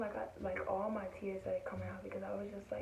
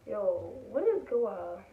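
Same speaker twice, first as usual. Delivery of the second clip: slow, drawn-out exasperation with a pitch swoop; exasperated disbelief; 6 dB louder, slower than usual. Retold or imitated. Student reacting to being told to sit down, retold exasperated.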